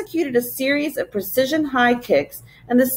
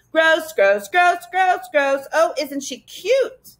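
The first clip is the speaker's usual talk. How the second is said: higher mocking creature echo; simpering sneer; 7 semitones higher, slower than usual. A narrator's reading. Creature mocking the angry girl, simpering and sneering. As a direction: mimic her word, then coo with mock sweetness. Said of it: "The creature simpered and sneered".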